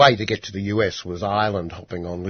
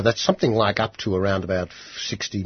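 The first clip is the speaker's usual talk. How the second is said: usual speaking voice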